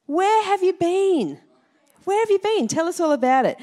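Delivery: high, excited welcome for a returning traveller; bubbly, high-pitched curiosity; eager welcome home. Shown as a demonstration. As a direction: greet them back from a great adventure, keen to hear everything. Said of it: "someone that has returned home for a great adventure".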